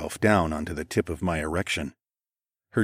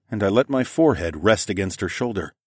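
plain reading voice

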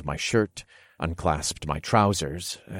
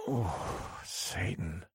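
pleasured groan; 11 dB quieter, much slower than usual. Acted. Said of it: "I groaned".

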